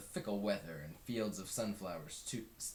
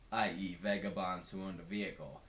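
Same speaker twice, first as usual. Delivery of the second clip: plain reading voice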